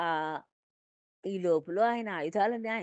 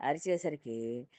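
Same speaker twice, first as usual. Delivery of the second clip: plain reading voice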